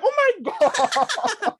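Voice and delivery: shocked gasp. Retold or imitated. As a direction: stretch it out in horror. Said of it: "I'm like".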